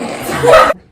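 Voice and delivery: high, loud breathy laugh; burst of laughter. Real, not acted. Newsreader breaking during sad news, bursting out laughing. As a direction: crack up suddenly.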